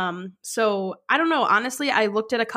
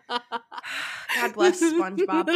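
laughing; 7 semitones higher, much slower than usual. Real, not acted. Laughing slow mock line.

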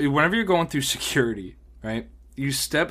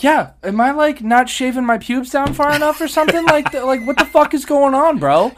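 loud, high, comic retold protest; exasperated disbelief; 12 semitones higher, 8 dB louder than usual. Traveler flagged by body scanner demanding a reason, exasperated. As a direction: plead loudly for an explanation, voice way up.

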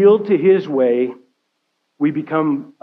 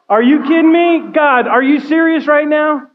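much higher, louder disbelief; incredulous complaint to God; 10 semitones higher, 4 dB louder than usual. Retold or imitated. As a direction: throw the question at God in disbelief. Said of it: "I would be marching around going".

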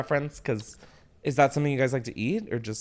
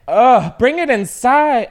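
shrieked, higher and much louder; scream; 10 semitones higher, 11 dB louder, slower than usual. Real, not acted. Yelling to someone outside to bring it in, a real scream. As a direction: yell it out at the house. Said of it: "why did I scream it into the microphone".

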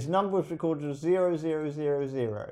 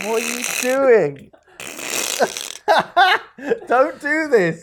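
slow pleading wail; 9 semitones higher, 10 dB louder, slower than usual. Pretend. Mock pleading over the dog.

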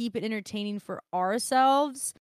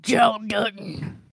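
slow gruff growled name; a lightly done John Dutton; John Dutton impression; 5 dB louder, slower than usual. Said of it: "Kevin Costner has to sound like that".

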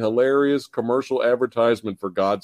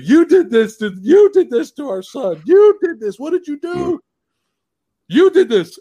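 much higher, louder accusation; mock outraged parent; 13 semitones higher, 6 dB louder than usual. Parent accusing the doctor over their son, high angry yell. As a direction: ham up the trailer's furious mother.

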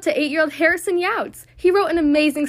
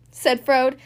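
ordinary delivery

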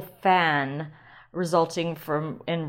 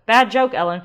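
self-scolding; 4 semitones higher, 10 dB louder than usual. Real, not acted.

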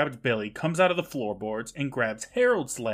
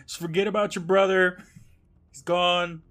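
higher-pitched mock uncle voice; callous uncle; 6 semitones higher than usual. Host mimics Harold abandoning his nephew, mock callous uncle. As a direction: coax like a dismissive uncle, pitched up then easing down.

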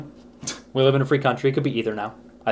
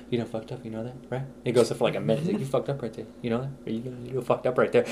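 much softer, menacing cop taunt; interrogating cop; 8 dB quieter, faster than usual. Retold or imitated. Cop taunting the suspect in the interrogation, much softer. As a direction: needle the suspect quietly.

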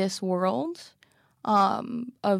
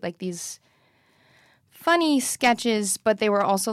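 ordinary talk, nothing marked

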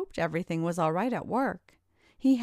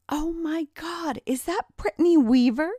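excited cry; 9 semitones higher, 6 dB louder than usual. A narrator's reading. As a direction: shriek it out in mock shock at an old classmate. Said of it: "cried out Carrie".

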